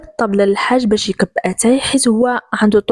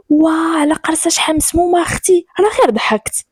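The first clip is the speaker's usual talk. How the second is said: high-pitched indignant cry; pained yelp; 7 semitones higher than usual. A narrator's reading. Girl pinched for laughing, crying out in protest. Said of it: "نقزات من حدايا وغوتات".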